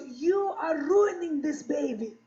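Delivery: put-on slow, disapproving Russian accent; mock Russian-accented reproach; Russian mother. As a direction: scold slowly as a Russian mother. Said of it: "Here's what my mother said to me".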